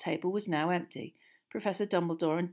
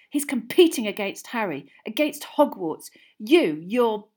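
loud angry accusation; spat out; 4 semitones higher, 9 dB louder than usual. A narrator's reading. Boy accusing his friend of disloyalty to school, spitting. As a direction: spit out each charge, higher and louder. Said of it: "spat Ron".

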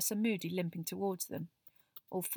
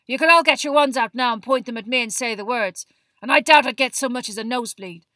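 loud, forceful lecturing voice, raised pitch; gruff teacher Moody; 4 semitones higher, 14 dB louder than usual. A narrator's reading. Moody warns his class about the killing curse, loud and forceful. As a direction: drive it at a whole class.

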